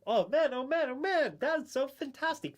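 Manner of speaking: high, loud, drawn-out oh man; mock delight. Reacting to the fake AI answer with hammed-up delight. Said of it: "mock delight at the answer".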